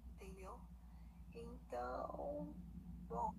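ordinary talk

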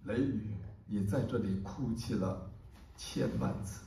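declaimed verse, swelling into urgent questions and falling back to hush; impassioned poem recitation. Acted. Poet shares a short, powerful poem with an audience, declaimed passionately. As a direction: recite with dramatic surges and hushed pauses.

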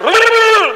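high, loud imitated buzz; vibrating noise. Mimicking praying pastors, loud high vibration. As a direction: trill loudly like a phone buzzing. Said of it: "cuando yo los veo que hacen".

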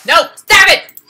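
high, loud shout; sharp shout. Real, not acted. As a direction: bark "Stop it!" at it. Yelling at game thing.

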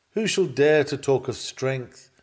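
usual speaking voice

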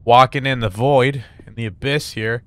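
usual speaking voice

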